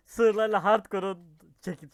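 laughing while spitting out candy; slow, laughing stumble. Real, not acted. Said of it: "şekeri tükürdüm".

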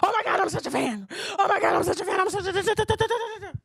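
mock-starstruck fan, laughing and babbling; giddy fan voice breaking into da da da; gushing fanboy impression. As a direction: ham up the fawning fan who can't get words out.

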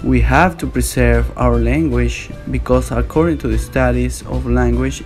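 ordinary talk, nothing marked

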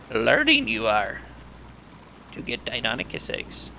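lightly voiced wise little master, words inverted; a weak, half-hearted Yoda impression. Gamer jokes in a mild Yoda impression near creepy swamp. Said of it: "weak attempt at a Yoda voice".

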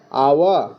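teacher modelling "আওয়া" as a falling ah-wa glide; low-falling "আওয়া", ah into wa; "আওয়া" sound demonstrated. Shown as a demonstration. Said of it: "ও ডাব্লিউ এর উচ্চারণ হয়".

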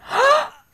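very high sharp gasp; shocked gasp. Real, not acted. Viewer shocked by a scene, sharp gasp. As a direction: gasp in real alarm.

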